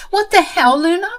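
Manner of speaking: high, loud furious shouting; acted screaming sister-in-law; angry scream. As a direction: yell it at her in rage. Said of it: "she practically screamed at me in anger".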